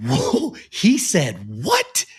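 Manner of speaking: high-pitched mock outrage; rising, incredulous squeal on WHAT; shocked disbelief. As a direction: act out a mom's stunned reaction. Retold mother's shocked, outraged reaction.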